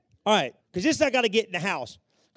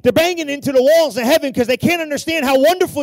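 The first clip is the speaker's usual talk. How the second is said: high, shouted sermon climax; full-force preaching; 7 semitones higher, 9 dB louder than usual. Real, not acted. Preacher tells congregation how wonderful they are, shouting at full force. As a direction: build to a shout, punching each phrase.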